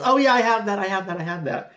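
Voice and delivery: put-on high, loud excited girl voice; eager yes. The girl she met, gushing excitedly about Snapchat. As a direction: gush it out, thrilled. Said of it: "she goes".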